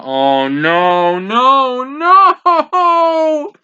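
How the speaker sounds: anguished no. Real, not acted. Viewer wailing as a beloved character is dying. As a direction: wail it as the loss sinks in. Reacting live to character's death.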